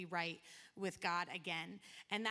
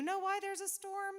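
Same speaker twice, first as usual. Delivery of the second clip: high, lightly voiced ship captain asking; captain's question; 11 semitones higher, 4 dB louder than usual. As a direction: suddenly pitch up as a puzzled captain. Captain questions Jonah about storm.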